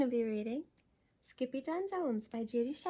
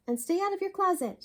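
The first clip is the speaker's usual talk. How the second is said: stern mother; 8 semitones higher, 5 dB louder, faster than usual. A narrator's reading. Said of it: "she added".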